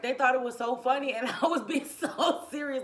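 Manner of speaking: high and loud, laughing through the words. Real, not acted. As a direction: giggle as you tell it. Room laughing at her.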